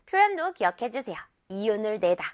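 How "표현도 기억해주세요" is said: normal talking voice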